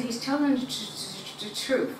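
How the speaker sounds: imitating herself stuttering on a word; retold stutter. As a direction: stumble repeatedly on the first sound of "truth". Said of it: "And I stutter. So I started stuttering".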